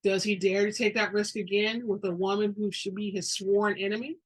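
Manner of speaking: ordinary delivery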